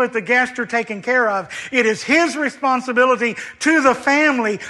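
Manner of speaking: impassioned sermon voice; preaching at full force. Acted. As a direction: drive the point home to the congregation.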